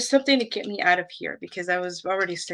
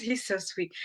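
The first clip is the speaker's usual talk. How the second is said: ordinary delivery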